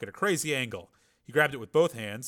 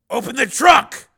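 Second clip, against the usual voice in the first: commanding; 13 semitones higher, 16 dB louder, slower than usual. A narrator's reading. Hero orders.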